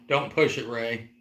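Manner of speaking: ordinary talk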